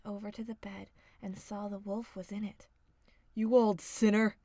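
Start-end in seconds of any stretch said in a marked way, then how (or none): 3.3-4.4 triumphant hunter. A narrator's reading. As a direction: bellow at the wolf. Hunter finds wolf.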